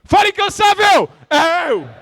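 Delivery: rally shout. Shown as a demonstration. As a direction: fire up the crowd. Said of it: "Vamos fazer junto?". (grito de guerra bem alto e agudo)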